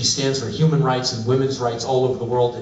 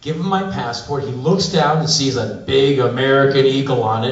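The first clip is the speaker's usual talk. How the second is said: ordinary talk, nothing marked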